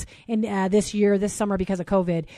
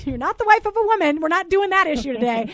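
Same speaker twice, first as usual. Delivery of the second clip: laughing; 7 semitones higher, 4 dB louder than usual. Real, not acted. Laughing at her own slip.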